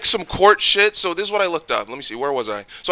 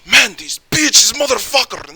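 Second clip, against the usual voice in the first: high, loud, exasperated cursing; pissed-off friend; 9 semitones higher, 5 dB louder than usual. Retold or imitated. Friend hearing the news about her, imitated as pissed off. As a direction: imitate your friend blowing up. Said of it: "he gets fucking pissed".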